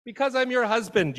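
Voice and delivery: high, loud mock-indignant husband; indignant spouse. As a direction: play the offended husband in an argument. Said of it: "you say".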